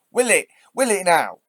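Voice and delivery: loud, high sarcastic challenge; furious rhetorical will it; angry retort. Real, not acted. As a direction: throw it back in anger.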